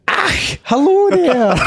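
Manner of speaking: slow, high, loud Highland Scottish greeting; hammed-up Highland Scottish accent. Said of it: "That's more Highlands than".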